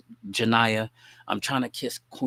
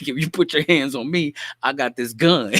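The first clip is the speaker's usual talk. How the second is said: higher and louder, threatening; father's gun threat; 4 semitones higher, 5 dB louder than usual. Retold or imitated. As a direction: warn him off with the pistol. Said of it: "So he like".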